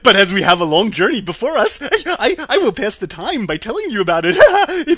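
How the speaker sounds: loud, high, stammering monkey cackle; hammed-up screechy giggling creature voice; monkey king laughing. A narrator's reading. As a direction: chatter it out through laughs. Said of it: "answered the king with a laugh".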